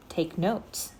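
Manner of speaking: ordinary delivery, nothing marked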